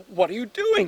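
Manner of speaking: high, loud startled question; startled girl. Acted. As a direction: blurt it out high, taken aback. Startled girl as ducks approach.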